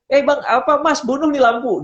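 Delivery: wife ordering her husband to turn off the lamp, blunt and high; blunt casual call. Retold or imitated. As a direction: tell him to kill the light, bluntly and high. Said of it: "matiin lampu itu bahasanya".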